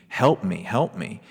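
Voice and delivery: ordinary talk, nothing marked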